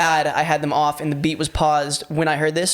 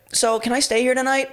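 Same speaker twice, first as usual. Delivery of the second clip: higher, oblivious stranger voice; shameless stranger; 8 semitones higher than usual. Retold or imitated. Intruder asks to stay the night, higher shameless voice. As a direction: ask with no shame at all. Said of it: "Just so not self-aware".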